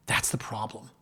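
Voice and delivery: hushed, breathy aside; whisper. Retold or imitated. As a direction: lean in and whisper it like a secret. Lawyer's quoted warning, repeated twice.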